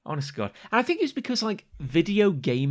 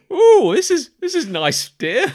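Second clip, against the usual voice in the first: mock cosy spouse; 10 semitones higher, 7 dB louder than usual. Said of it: "you can sit there with a book going".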